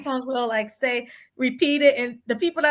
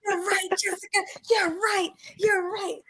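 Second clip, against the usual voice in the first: mock adoring panel; 5 semitones higher, 4 dB quieter than usual. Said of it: "Jessica X will say it in her accent".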